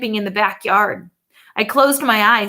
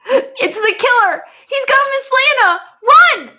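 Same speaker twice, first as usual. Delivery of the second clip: panicked shout; 7 dB louder than usual. A narrator's reading. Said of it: "I shouted".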